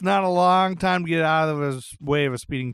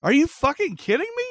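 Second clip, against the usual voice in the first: high-pitched, astonished exclamation; real, stunned disbelief, pitched way up; incredulous outburst; 10 semitones higher, 4 dB louder than usual. Real, not acted. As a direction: burst out in total disbelief. Astonished that no one died.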